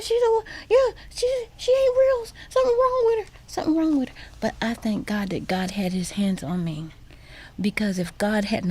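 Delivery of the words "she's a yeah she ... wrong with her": put-on much higher, crying boy running to mom; high frightened sobbing shriek; screaming child. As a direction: wail it like a terrified kid. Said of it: "he started screaming, telling his mom".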